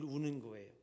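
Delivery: usual speaking voice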